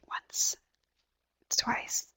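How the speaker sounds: whispered silent counts. Shown as a demonstration. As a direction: barely breathe the first counts. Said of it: "two times silent and start counting on the third one".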